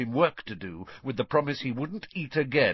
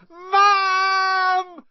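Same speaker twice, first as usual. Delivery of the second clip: long, high, loud wail for mum; howling; 12 dB louder than usual. A narrator's reading. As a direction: howl for help at full pitch. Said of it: "howled Dudley".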